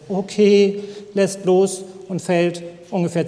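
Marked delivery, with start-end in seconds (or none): none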